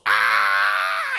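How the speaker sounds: low, loud death bellow; dying roar. Retold or imitated. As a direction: roar as you go up in flames. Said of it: "and he just goes".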